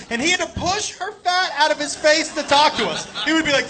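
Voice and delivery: ordinary talk, nothing marked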